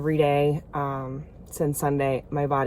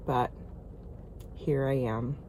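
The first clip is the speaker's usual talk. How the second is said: sleepy husky good morning; 3 semitones lower, 10 dB quieter than usual. Real, not acted. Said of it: "This is my morning voice".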